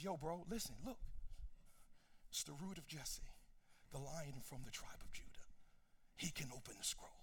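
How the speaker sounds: the elder comforting weeping John, soft and casual. Acted. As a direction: lean in like a friend saying it's okay.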